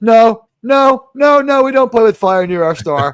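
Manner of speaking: loud firm no, no; emphatic refusal. Retold or imitated. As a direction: insist loudly, scandalized. Crew refusing near star.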